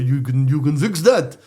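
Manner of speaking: halting, badly faked German accent. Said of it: "I'm gonna do a bad German accent here".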